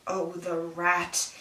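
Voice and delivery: slow, soft, bitter mutter; muttered dramatic aside. Acted. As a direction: drag it out softly, betrayed. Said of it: "here's Karagos".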